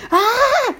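glapissement très aigu et très fort; cri de douleur. A narrator's reading. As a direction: hurle de douleur d'un coup. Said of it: "hurla-t-il". (le père hurle quand on tire sur son chapeau)

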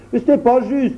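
high-pitched, indignant protest; indignant cry. A narrator's reading. Hassel protesting the guilty verdict, crying out. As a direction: cry out against the unfairness. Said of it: "cria-t-il".

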